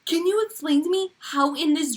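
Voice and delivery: high-pitched, amazed appeal; astonished, high exclamation to everyone; real incredulity. Real, not acted. Amazed her dream matches the video.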